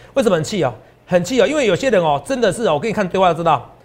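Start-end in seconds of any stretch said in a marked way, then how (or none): none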